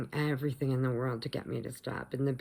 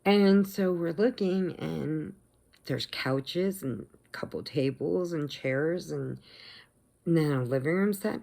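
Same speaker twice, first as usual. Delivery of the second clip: usual speaking voice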